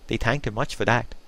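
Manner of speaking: plain reading voice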